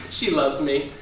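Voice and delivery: ordinary talk, nothing marked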